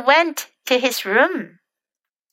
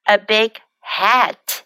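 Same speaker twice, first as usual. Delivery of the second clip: slow careful demo; slower than usual. Shown as a demonstration. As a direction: stretch each word for the class. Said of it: "teacher repeats phrase slowly".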